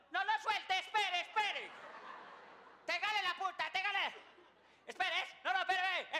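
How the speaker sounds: high, loud, repeated orders; frantic shouting. Acted. Man directing a balloon launch, shouting high and urgent. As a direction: shout urgent instructions at the helpers. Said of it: "Y hay uno gritando".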